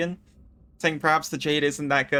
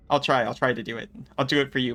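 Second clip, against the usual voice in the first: normal talking voice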